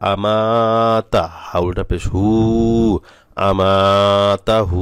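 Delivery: আমাতাহু chanted very slow with long vowels; drawn recitation. Shown as a demonstration. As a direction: stretch আমাতাহু for the class. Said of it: "এখানে টান হবে".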